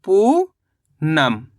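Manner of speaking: पूनम stretched out very slowly at the end; slow drawn-out word. Shown as a demonstration. Teacher revealing the finished word to pupils, very slowly. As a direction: draw out पूनम slowly as the answer. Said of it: "तो मिलके बन गया ये".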